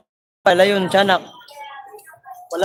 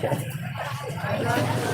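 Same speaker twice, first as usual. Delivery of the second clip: breathy laugh; 11 dB quieter than usual. Real, not acted. Laughing after joke.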